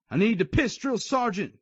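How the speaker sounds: defiant high shout. Acted. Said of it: "I shouted".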